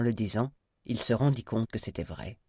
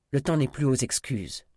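raised, curt rebuff; brutal reply; 4 semitones higher, 5 dB louder than usual. A narrator's reading. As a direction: cut him off harshly, louder and higher. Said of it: "répondit-il brutalement".

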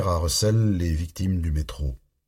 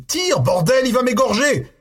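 much higher, louder panicked plea; terrified pleading; 4 dB louder than usual. A narrator's reading. Jimmy, knife at his throat, begs Russell to shoot, terrified scream. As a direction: beg for your life, screaming. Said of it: "implora Jimmy".

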